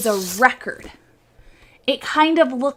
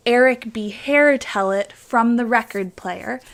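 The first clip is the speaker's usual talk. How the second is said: usual speaking voice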